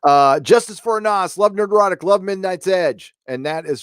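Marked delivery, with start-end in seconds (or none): none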